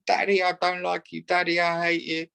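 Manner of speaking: high mock tantrum of a little girl; child's tantrum. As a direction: whine it like a small child in a strop. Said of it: "she really throws a tantrum, screaming at him".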